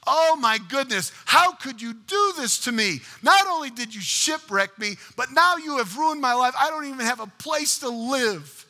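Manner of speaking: high, loud acted outrage; angry at God. Stranded survivor blaming God for the burned hut, angrily crying out. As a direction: rail upward in aggrieved protest. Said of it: "he is so angry at God".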